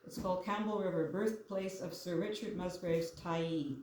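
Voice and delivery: usual speaking voice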